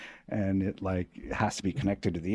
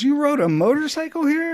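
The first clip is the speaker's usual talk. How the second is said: incredulous doctor; 6 dB louder than usual. Retold or imitated. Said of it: "He goes".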